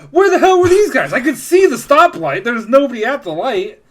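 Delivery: high, loud exasperated outburst; frustrated yelling at traffic. Retold or imitated. Frustrated rant quote at traffic.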